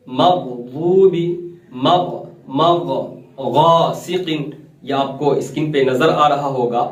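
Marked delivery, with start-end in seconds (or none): 0.1-2.9 मगदूबी with a thick throaty gh; slow recitation demo, heavy gh in मगदूबी; full ghain. Shown as a demonstration. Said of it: "हमेशा पुर पढ़ा जाने वाला". 3.5-4.5 गासिकिन with a thick throaty Arabic gh; full ghain. Shown as a demonstration. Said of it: "हमेशा पुर पढ़ा जाने वाला".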